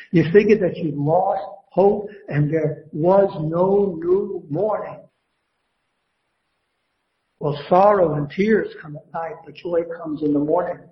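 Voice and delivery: normal talking voice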